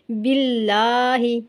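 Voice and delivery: बिल्लाही with a light, thin ल, slow; slow tajweed recitation with thin lam. Shown as a demonstration. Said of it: "हम बारीक पढ़ेंगे".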